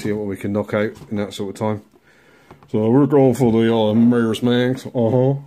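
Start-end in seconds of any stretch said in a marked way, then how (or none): 2.7-5.4 louder, slowed American crooner accent, badly done; a deliberately bad American rock-and-roll accent with a lazy uh-huh; a hammed-up, poor American crooner accent. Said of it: "that's a shit accent".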